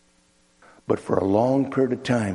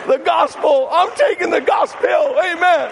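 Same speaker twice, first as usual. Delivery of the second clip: high, loud shout to the congregation; exultant preaching; 5 dB louder than usual. Real, not acted. Preacher proclaiming the gospel as the cure, exultant shout. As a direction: proclaim it at full force.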